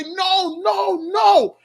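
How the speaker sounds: high, wailing imitation of a distraught father; nearly crying. Father running to his daughter, nearly crying. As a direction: wail each word high, close to tears. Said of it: "he nearly start crying".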